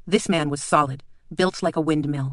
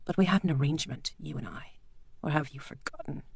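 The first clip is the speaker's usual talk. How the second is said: quiet menacing doctor; 7 dB quieter than usual. Acted. Bleak quietly reminds the lord of their deal.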